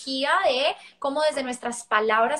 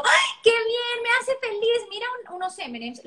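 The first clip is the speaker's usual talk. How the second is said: gushing praise; 9 semitones higher than usual. Retold or imitated. Parent handing candy for potty, mock cooing. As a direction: overdo the sweet reward voice. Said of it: "y yo le digo".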